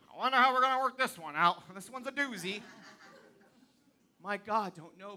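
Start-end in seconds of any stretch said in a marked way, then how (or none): 0.1-2.6 fast, dry, mock-fretful aside that trails off; mock worried God. Preacher mocking the idea of a panicked God, fast and dry. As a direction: play a God who is stumped, tongue in cheek. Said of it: "What is God sitting up there going".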